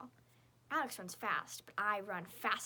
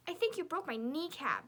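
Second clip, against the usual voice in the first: whimpering; 6 semitones higher, 5 dB louder than usual. A narrator's reading. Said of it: "Victoria whimpers".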